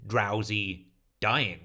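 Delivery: ordinary delivery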